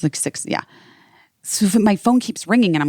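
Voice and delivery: usual speaking voice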